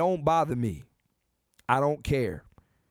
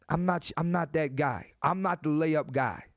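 ordinary delivery

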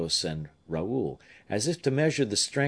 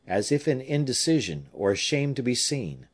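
ordinary delivery, nothing marked

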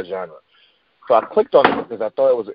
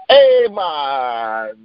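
laid-back mock Rasta "hey, man"; hammed-up Jamaican accent; mock Jamaican greeting; 3 semitones higher than usual. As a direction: put on a lazy Jamaican drawl. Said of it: "put a fucking Rasta wig on".

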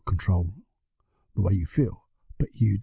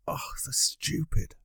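slow, softer unvoiced whisper; self-blaming whisper; 3 semitones higher, 11 dB quieter, slower than usual. Whispering the inner voice that scolds yourself. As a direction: breathe it out as self-reproach. Quoting own self-blame.

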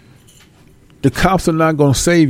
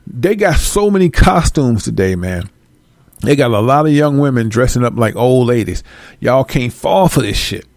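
normal talking voice

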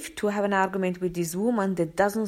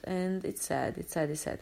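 sad; 3 semitones lower, 8 dB quieter than usual. Real, not acted. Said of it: "So sad".

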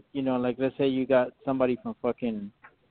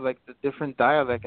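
ordinary talk

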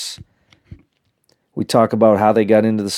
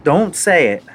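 retold dad warning; 7 semitones higher than usual. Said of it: "I was like".

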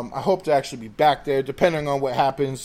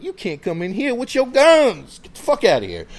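retold order; 6 semitones higher, 3 dB louder than usual.

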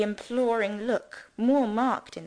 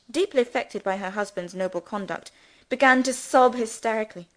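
ordinary delivery

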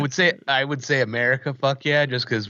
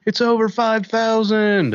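meme quote; 10 semitones higher, 4 dB louder than usual. Retold or imitated. Quoting the meme.

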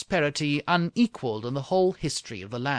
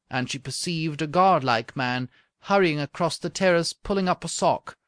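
ordinary delivery

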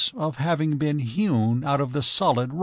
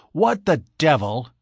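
demanding; 3 semitones higher, 4 dB louder than usual. Acted. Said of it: "demanded Mister Brewster".

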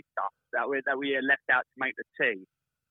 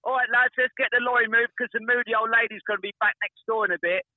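retold shout; 6 semitones higher than usual. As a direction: call it down from the scaffold, half-playful. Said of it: "He shouted back down".

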